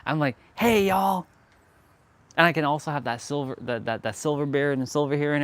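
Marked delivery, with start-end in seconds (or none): 0.5-1.2 higher, louder Southern drawl greeting. Pretend. Pretending to be a Southern walker-class instructor, Southern accent greeting. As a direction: greet like a Southern fitness host.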